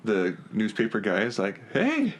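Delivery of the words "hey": high-pitched "hey... you" with a pause; mock calling out. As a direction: imitate someone calling out in a high voice. Retold newspaper guy calling out.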